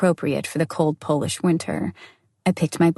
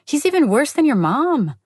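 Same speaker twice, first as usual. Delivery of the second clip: exasperated; 9 semitones higher, 3 dB louder than usual. A narrator's reading. Olga continues her rant.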